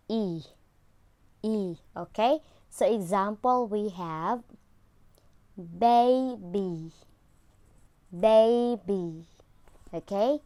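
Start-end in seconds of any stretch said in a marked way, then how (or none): 0.1-1.8 E spread wide from side to side; long E sound. Shown as a demonstration. Teacher showing pupils the long E sound. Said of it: "from side to side". 5.6-9.3 put-on baby ending in a smiling long E; model word baby. Shown as a demonstration. Teacher modelling baby with the long E. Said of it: "So it has to smile".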